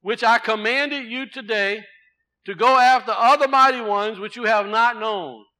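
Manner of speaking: slow, loud, high proclamation of scripture; booming scripture reading. Acted. Preacher reading the warning to the congregation, booming. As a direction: thunder the verse out to the congregation.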